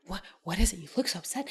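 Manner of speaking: whispered, hushed Claire; mock Claire whisper. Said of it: "Claire's like".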